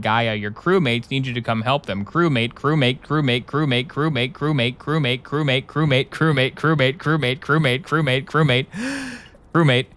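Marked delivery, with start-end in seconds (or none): 2.0-8.7 rhythmic, relentless chant on one repeated tune; drilling it in. Acted. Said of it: "You're trying to drill it in". 8.7-9.2 high, quiet sucked-in gasp; big inhale between chanted words; gasp for breath. Acted. 9.5-9.9 rhythmic, relentless chant on one repeated tune; drilling it in. Acted. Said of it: "You're trying to drill it in".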